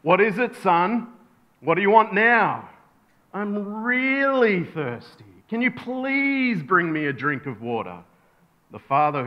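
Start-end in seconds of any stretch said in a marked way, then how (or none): 0.0-2.7 higher, mildly exasperated dad; a bit agitated. Acted. Father answers his son's second call, mildly agitated. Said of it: "starting to get a little bit agitated now". 3.3-8.0 put-on drawn-out, whiny child's plea; pleading little boy. Son begs father for a drink, drawn-out pleading.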